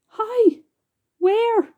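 high, loud eager questions; urgent questioning. A narrator's reading. James demanding where string is, high eager questions.

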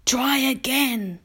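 high, slow, kindly coaxing; old grasshopper. A narrator's reading. Old grasshopper prompts James to guess, kindly and slow. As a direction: coax slowly with a smile. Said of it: "said the old green grasshopper, smiling".

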